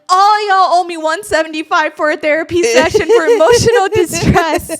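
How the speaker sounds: loud put-upon demand; mock indignant demand. Sister billing the family for emotional distress. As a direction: play up the outrage. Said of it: "she's like".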